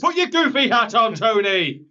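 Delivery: high, loud commentator imitation; mock commentator shout. Imitating Jesse Ventura heckling his broadcast partner, mock shout. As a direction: yell it like a hammed-up commentator. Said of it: "He says".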